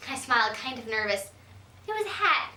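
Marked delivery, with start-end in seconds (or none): none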